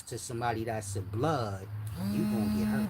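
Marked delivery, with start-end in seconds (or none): 2.1-2.9 high, loud warning burst; sudden shout. Real, not acted. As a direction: bark the threat out to scare them straight. Warning kids about saying cuz.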